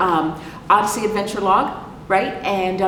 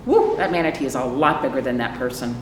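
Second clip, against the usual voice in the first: ordinary delivery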